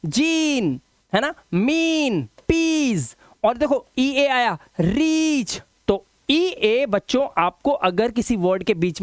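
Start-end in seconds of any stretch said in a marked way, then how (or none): 0.0-3.1 "जीन, मीन, पीस" with long raised ee; higher, drawn-out ee in "जीन, मीन, पीस"; long vowel. Shown as a demonstration. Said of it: "long vowel sound देता है". 4.8-5.6 "रीच" with a long raised ee; higher, drawn-out "रीच"; long vowel. Shown as a demonstration. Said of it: "long vowel sound देता है".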